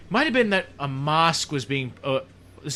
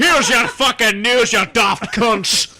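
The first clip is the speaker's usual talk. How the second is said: higher, louder, slow mock newsreader cursing; lightly voiced foul-mouthed Scottish newsreader; swearing Scottish newsreader; 5 semitones higher, 7 dB louder, slower than usual. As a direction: play a Scottish anchor who swears at viewers. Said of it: "Even the newsreaders swear in Scotland".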